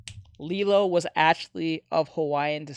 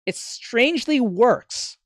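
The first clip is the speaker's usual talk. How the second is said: slow emphasis; 5 semitones higher, 5 dB louder, much slower than usual. Real, not acted.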